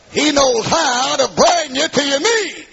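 high, slow preacher's cry; full-force preaching. Real, not acted. Preacher warning that nobody is too tough, full-force preaching. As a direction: cry it out high, stretching the words.

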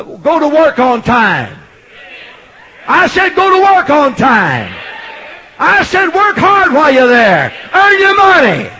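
high, shouted exhortation; full-force preaching. Real, not acted. Preacher telling churchgoers how to work, building shout.

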